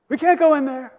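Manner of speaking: high, loud mock protest; acted shouted refusal. Spies refusing to enter the land, acted high shouting. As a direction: cry out the refusal to everyone.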